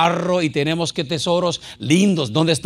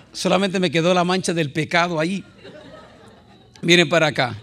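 ordinary talk

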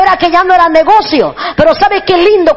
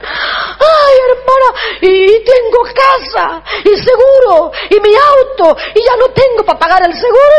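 mock crying; 7 semitones higher than usual. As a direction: imitate a pastor sobbing over all he lost. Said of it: "¿Por qué llora?".

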